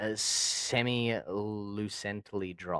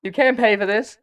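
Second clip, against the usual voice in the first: squeaky pitched-up tease; high cartoon voice taunting; high filtered voice; 12 semitones higher, 9 dB louder than usual. Filter voice, playing hooker.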